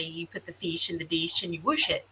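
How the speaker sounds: "fish" as feesh, "dish" as deesh; mimicking mother's accent. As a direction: say "whoosh" for wash, "fish" and "dish" with ee. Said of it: "we would say".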